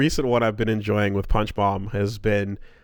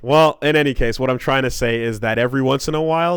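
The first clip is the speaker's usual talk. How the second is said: ordinary delivery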